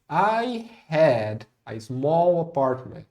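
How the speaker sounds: very slow, evenly spaced English; slow model sentence. Shown as a demonstration. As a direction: say it slowly for learners to follow. Teacher models English sentence slowly.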